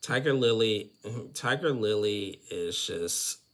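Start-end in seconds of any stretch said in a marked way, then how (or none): none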